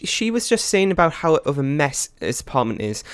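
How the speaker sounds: ordinary delivery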